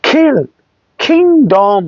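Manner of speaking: খিল and কিংডম with K like খ; aspirated K. Shown as a demonstration. Teacher drilling K words, aspirated খিল, কিংডম. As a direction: puff into খিল, then কিংডম. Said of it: "খর মতো উচ্চারণ হবে".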